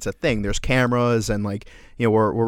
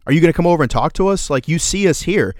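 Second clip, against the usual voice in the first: retold call-out; 7 semitones higher, 4 dB louder, faster than usual. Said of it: "he was just like".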